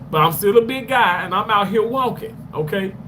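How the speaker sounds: ordinary talk, nothing marked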